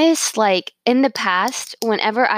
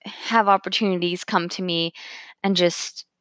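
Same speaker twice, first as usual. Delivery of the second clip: ordinary talk, nothing marked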